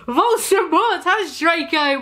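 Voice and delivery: mild read-aloud shout. A narrator's reading. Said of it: "we shouted at the same time".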